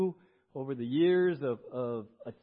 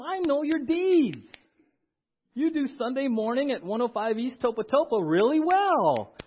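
voicing Jesus; 7 semitones higher, 7 dB louder than usual. Pastor voicing Jesus affirming church.

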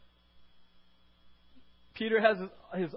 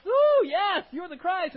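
high, loud, giddy exclamation; elated Peter; 13 semitones higher, 13 dB louder, slower than usual. Pretend. Peter elated at confessing Jesus, high giddy cry. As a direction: squeal it like you're floating.

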